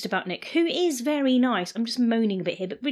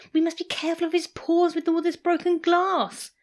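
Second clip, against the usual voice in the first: higher, fussy voiced Sarah; fussing Sarah; 8 semitones higher than usual. A narrator's reading. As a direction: fret over the dog's paws. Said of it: "all too busy shouting".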